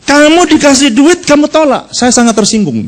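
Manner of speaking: mock indignant outburst; angry foreigner. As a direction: act out his offence. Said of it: "Bulenya marah".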